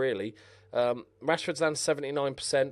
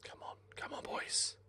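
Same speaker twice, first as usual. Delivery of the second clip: whispered urging; 13 dB quieter than usual. Real, not acted. Gamer whispers encouragement to his team. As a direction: whisper it like praying.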